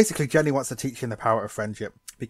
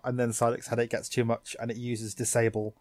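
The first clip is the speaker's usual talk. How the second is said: normal talking voice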